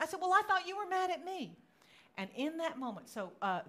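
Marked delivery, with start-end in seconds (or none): 0.2-1.6 high, loud retort, acted out; hammed-up exasperation; exasperated protest. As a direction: retell a baffled self-justification. Acts out the misunderstanding.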